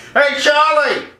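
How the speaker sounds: put-on high, called-out counter girl; hammed-up high shout; horrible woman's voice. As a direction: holler to a coworker in back. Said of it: "Horrible sounding voice for a woman".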